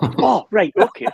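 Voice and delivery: startled realisation. Real, not acted. Learns baby arriving in minutes.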